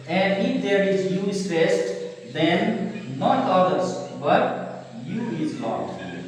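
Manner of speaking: ordinary talk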